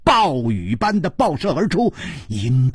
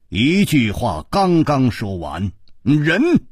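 ordinary delivery

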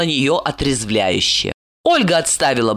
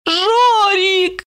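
high, drawn-out singsong name; stretched coaxing call. A narrator's reading. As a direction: sing out the name, high and tipsy. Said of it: "пропела она".

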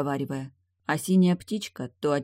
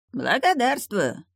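slow, smug and much higher; smug grin; 8 semitones higher, 3 dB louder, slower than usual. A narrator's reading. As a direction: drawl it with a pleased smirk. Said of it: "довольно ухмыльнулся встречник".

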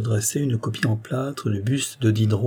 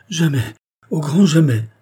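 high, louder indignant denial; wounded, emphatic protest; indignant sigh; 6 semitones higher, 6 dB louder than usual. A narrator's reading. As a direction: deny it emphatically, pitched up and offended. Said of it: "soupira-t-elle indignée".

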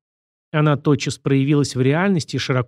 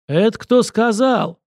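higher, drawn-out indignant demand; belligerent challenge; 8 semitones higher, 4 dB louder, slower than usual. A narrator's reading. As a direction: puff up and demand who dared. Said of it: "воинственно приосанился Карасиков". (воинственно, с вызовом)

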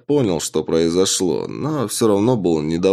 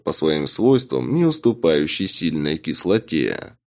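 ordinary delivery, nothing marked

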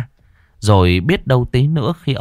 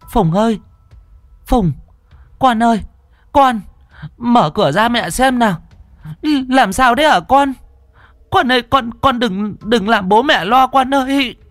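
much higher, louder desperate cries; frantic calling; 4 dB louder than usual. Acted. Mother banging on her son's door, frantic shouting. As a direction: shout through the door in panic.

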